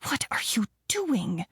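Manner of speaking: sharp hushed hiss; hissed whisper. Acted. Mother hissing at her late daughter, hushed whisper. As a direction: hiss it so guests don't hear. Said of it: "she hissed".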